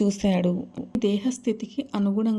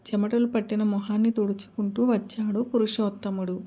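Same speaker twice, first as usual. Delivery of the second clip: usual speaking voice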